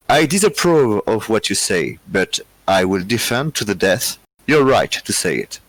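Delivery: slow, careful English in a slightly German accent; German-tinged English. Real, not acted. Quiz host reads the original English quote, German-tinged accent. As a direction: read it slowly with an accented, German-leaning English. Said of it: "j'ai plutôt un accent allemand".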